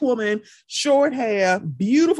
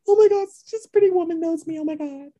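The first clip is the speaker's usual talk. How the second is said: inner scream; 8 semitones higher, faster than usual. Retold or imitated. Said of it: "in my head, I'm, like, screaming".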